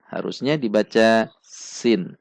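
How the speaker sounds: ordinary talk, nothing marked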